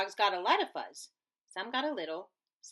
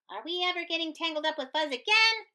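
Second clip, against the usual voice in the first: high, fast eager question; rally the crowd with a question; cried out; 8 semitones higher, 4 dB louder, faster than usual. A narrator's reading. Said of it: "cried Pipsqueak".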